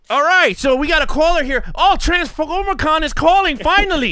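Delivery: mock radio host taking a call. Podcast host imitating a radio host taking a caller. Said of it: "He'd be like".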